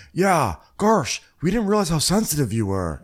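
a hint of Shep's sarcasm; sarcastic Shep. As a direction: fake an apology. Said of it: "Shep's like".

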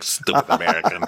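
normal talking voice

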